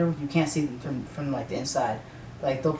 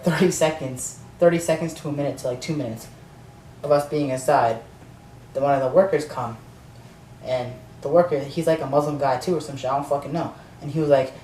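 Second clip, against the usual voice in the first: ordinary delivery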